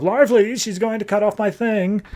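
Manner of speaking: high-pitched plea to wake someone; mock panicked call. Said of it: "try to wake Larfleeze back up".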